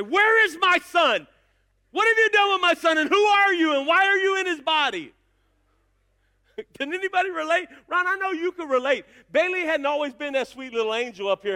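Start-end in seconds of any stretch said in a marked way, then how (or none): 0.0-5.1 mock outraged parent confronting a teen; exasperated demand. Father to his teenage son, in mock exasperation. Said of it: "I wanted to grab him and say".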